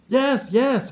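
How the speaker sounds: high, loud, excited agreement; keen, high-pitched agreeing; eager. A narrator's reading. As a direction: jump in eagerly, pitch right up. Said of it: "Vlad nodded eagerly".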